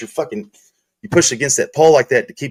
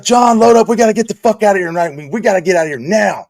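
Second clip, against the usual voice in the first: higher, louder rushed order; urgent order; 7 semitones higher, 5 dB louder than usual. Retold or imitated. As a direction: hurry him out, voice raised. Coworker's urgent retold order.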